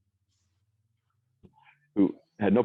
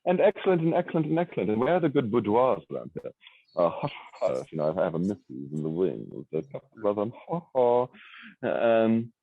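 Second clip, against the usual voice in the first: much higher put-on Oxford English accent; high posh Oxford English voice; 6 semitones higher than usual. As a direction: ham up a smug Oxford English gent. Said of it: "if I speak like this".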